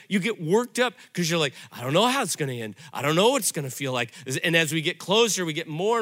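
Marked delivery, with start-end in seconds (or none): 1.7-4.1 fast, worried rush of words; anxious rush. Retold or imitated. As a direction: hurry it like someone fretting. Voicing anxious listener.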